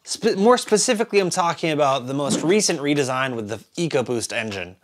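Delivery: ordinary delivery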